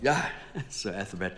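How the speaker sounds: a short laugh before the name; chuckle, then answer with polite amusement; light laughing reply; amused laugh. Acted. Amused reply to his superior.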